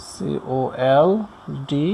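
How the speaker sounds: very slow, soft, one letter at a time; slow spelling. Shown as a demonstration. Teacher spelling out cold for learners, slow spelling. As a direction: spell it quietly with gaps between letters.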